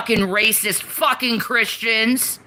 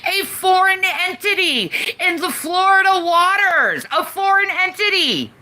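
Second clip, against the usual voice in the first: heated rant; 8 semitones higher than usual. Real, not acted. Caller's heated rant repeated.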